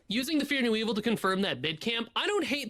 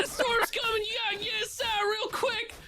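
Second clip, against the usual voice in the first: high, shouted Tornado Alley storm panic with a crying edge; panicked Tornado Alley accent; 13 semitones higher than usual. Pretend. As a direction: yell the warning in a Tornado Alley accent, near tears. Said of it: "That's what I sound like whenever a storm approaches".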